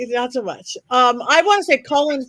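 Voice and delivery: ordinary talk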